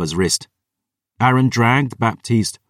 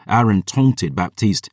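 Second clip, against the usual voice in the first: ordinary delivery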